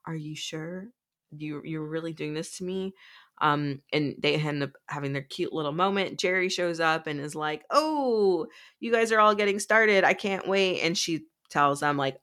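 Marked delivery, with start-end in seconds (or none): 0.1-2.9 softly acted wounded question; hushed mock heartbreak; hurt, hushed disbelief. Retelling a lovesick character's hurt question. 7.7-10.8 higher, louder mock delight; imitated giddy anticipation, easing off at the end; eager excitement. Acting a character's eager arrival.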